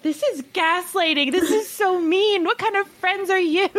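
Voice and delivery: high, indignant mock outrage; retold anger. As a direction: act out feeling betrayed and furious. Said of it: "I was so angry".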